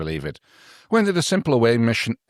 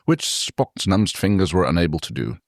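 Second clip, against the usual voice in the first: ordinary delivery, nothing marked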